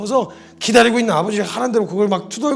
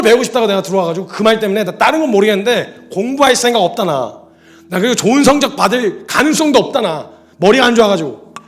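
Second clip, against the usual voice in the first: retold vow; 4 semitones higher, 9 dB louder than usual. Son's tearful vow to father.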